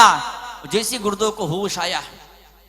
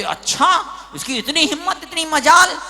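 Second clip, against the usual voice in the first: raised, indignant mock outcry; outraged crowd; 7 semitones higher than usual. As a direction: voice the crowd's indignation, pitched high. Acts out the outraged crowd's reaction.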